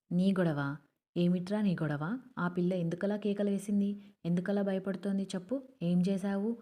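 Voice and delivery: ordinary delivery, nothing marked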